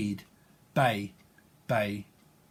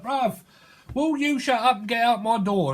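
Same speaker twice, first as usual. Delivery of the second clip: high, louder mock telling-off; acted irritated eruption; exasperated outburst; 7 semitones higher, 4 dB louder than usual. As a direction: snap at an intruder, pitched right up. Acted row with someone at door.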